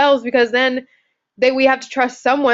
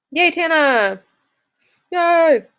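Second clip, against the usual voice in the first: high, drawn-out happy cheer; cheering welcome; 7 semitones higher, much slower than usual. Real, not acted. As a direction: cheer the volunteer on. Host cheers a volunteer raising hand.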